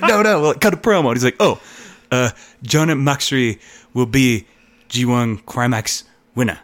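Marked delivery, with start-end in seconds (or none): 0.0-1.1 higher, louder imitated protest; mock urgent correction. Acting out Moxley correcting Shota. 1.4-6.6 put-on halting, slow Japanese-accented English; louder, deliberate mock Japanese accent; imitated Japanese accent. Imitating Shota's halting promo.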